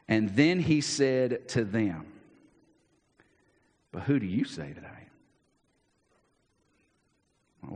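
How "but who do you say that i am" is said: hushed solemn question. Acted. Jesus' key question, slowed and hushed.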